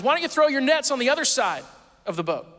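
high, loud shout to a boat; calling out. Retold or imitated. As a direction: imitate someone hollering advice from afar. Stranger calling from the shore.

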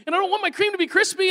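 mock kid disgust. Son refusing to eat cream donuts, high whiny mock kid. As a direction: whine it like a grossed-out little boy. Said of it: "he's picturing something that just in his mind is gross".

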